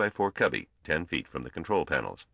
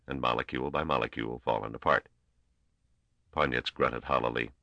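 ordinary delivery, nothing marked

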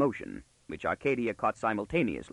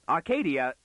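higher exclamation on the name; startled father; 5 semitones higher than usual. Acted. Father bursting in on his daughter, startled. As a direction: call out in mild shock.

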